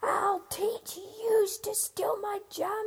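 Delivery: slow, high, raging threat; angry shout. Acted. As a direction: snarl it at the thieving flies. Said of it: "he shouted".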